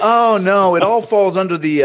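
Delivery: high-pitched breezy dismissal, imitated; retold brush-off. Director brushes off malpractice worry.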